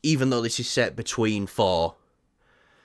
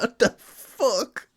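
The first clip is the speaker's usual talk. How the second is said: shocked; 12 semitones higher, much slower than usual. Retold or imitated. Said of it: "It's like".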